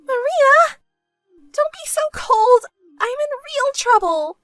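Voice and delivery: very high, loud, frantic begging; desperate pleading. Acted. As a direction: cry out to a sister who won't help. Begging sister who refuses her.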